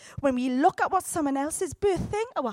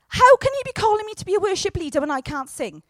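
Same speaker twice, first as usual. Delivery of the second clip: mock protest; 6 semitones higher, 8 dB louder, faster than usual.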